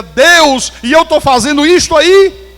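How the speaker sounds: high, loud desperate cry to God; a loud pleading wail, voice pushed high; anguished outcry. Retold or imitated. Crying out to God, repentant.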